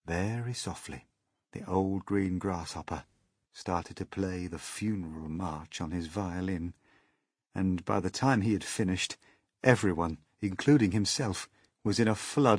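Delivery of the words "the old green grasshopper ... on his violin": ordinary delivery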